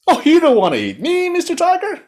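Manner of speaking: very high, loud frog voice; high, wheedling little frog; pleading frog character. A narrator's reading. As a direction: squeak it up to the tiger. Frog talks tiger out of eating.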